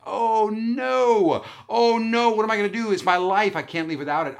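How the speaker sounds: high, loud wail easing off at the end; hammed-up despair over a lost treasure; mock panic. Retold panic over forgotten computer.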